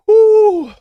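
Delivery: high, loud "whew"; loud whoop. Real, not acted. Fan worked up before the fight, loud high whoop. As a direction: let out a big high whoop.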